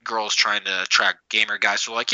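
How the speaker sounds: normal talking voice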